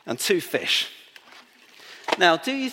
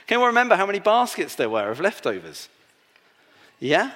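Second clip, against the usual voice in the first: ordinary delivery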